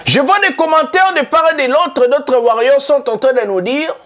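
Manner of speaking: rousing talk-show harangue; fired-up declamation. Acted. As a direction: call out the fans from the pulpit. Host launches into heated address.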